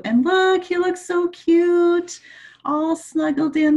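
delighted cooing; cooing excitedly. Real, not acted. Cooing over cute picture.

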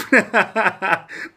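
loud high laugh at the price; burst of laughter. Real, not acted.

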